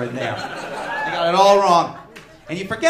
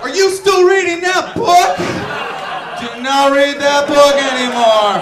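loud, slow shouted retraction; God shouting at humans to drop the book; God backpedalling; 5 semitones higher, 8 dB louder, slower than usual. Retold or imitated. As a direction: shout the apology down from heaven. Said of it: "he isn't immediately just gonna be like".

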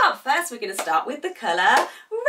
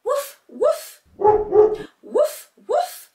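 high, spoken dog barks; playful high woofs; dog barking; 5 semitones higher, much slower than usual. Acted. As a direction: imitate a little dog for children. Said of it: "It's going".

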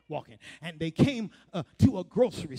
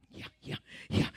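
whisper; 5 dB quieter, faster than usual. Real, not acted. Aside after laughter.

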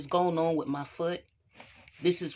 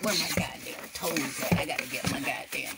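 whisper; 3 semitones lower than usual. Acted. Searching for fallen toes.

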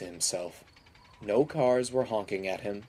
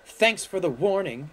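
higher, sarcastic angry retort; snarled; 8 semitones higher, 4 dB louder than usual. A narrator's reading. Mavis glaring at George after derailing, snarling. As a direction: snap it with bitter sarcasm. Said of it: "she snarled".

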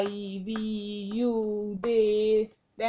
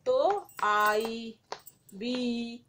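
ordinary talk

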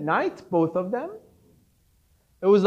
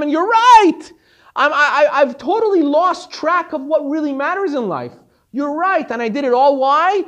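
higher and louder, pleading in frustration; exasperated protest; 4 semitones higher, 5 dB louder than usual. Retold or imitated. Disciple protesting to his rebbe, exasperated and loud. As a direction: throw your hands up at the rebbe.

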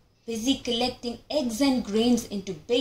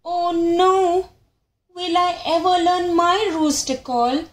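higher, louder despair; cry out; 7 semitones higher, 5 dB louder than usual. Acted. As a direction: wail it in frustration. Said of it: "cries out Roo Roo".

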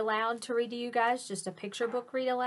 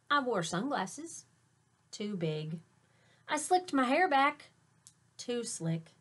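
expressive picture book read aloud; story narration; slower than usual. A narrator's reading. Teacher reading a picture book to her students, lively storytelling. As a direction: read it to your class like a storyteller.

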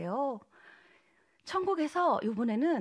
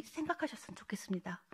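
soft whisper; 3 semitones lower, 10 dB quieter than usual. Real, not acted. Soft closing exhortation.

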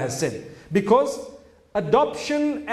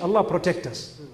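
normal talking voice

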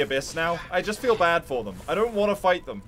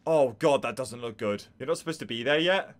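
ordinary talk, nothing marked

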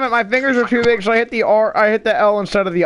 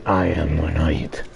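Batman voice; 7 dB quieter than usual. Retold or imitated. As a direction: growl it low like Batman. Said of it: "it sounded like I was Batman".

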